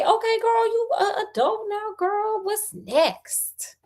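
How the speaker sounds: put-on teasing high-pitched girlfriend voice; playful high aside. Reader to the birthday girl, playful high-pitched teasing. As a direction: squeal it lightly, high and playful.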